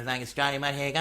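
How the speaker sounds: casual English-accented patter. Shown as a demonstration. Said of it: "like an English one is".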